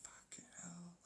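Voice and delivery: a breathy, voiceless swear; whispered curse. Real, not acted. Giving up on the humans, a whispered swear. Exasperated aside.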